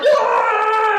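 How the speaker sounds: long, high, loud whoop; group cheer. Acted. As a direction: roar along with the celebrating crowd.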